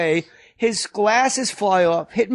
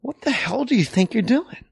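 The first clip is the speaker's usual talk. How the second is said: higher-pitched fake-angry scolding; mock annoyed scolding; 5 semitones higher than usual. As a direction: scold him in a hammed-up huff. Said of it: "One of his go-to characters is the, like, mock".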